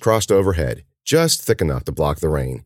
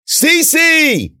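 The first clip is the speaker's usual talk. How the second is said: high, loud shout of a name; drawn-out desperate call; crying out; 6 dB louder than usual. A narrator's reading. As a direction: yell for someone lost in a storm. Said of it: "he cried".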